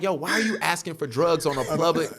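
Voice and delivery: high, loud, rushed disbelief; mock scolding. Telling Instagram strangers off for asking about drugs, loud and rushed. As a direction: lecture them fast, half laughing.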